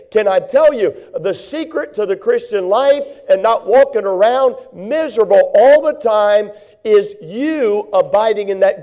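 loud, driving sermon delivery, every phrase punched; full-force preaching. Acted. Pastor rousing his congregation, loud and driving. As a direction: preach it hard and build the fire.

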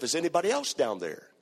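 usual speaking voice

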